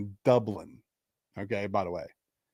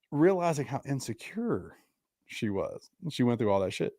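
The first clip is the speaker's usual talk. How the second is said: normal talking voice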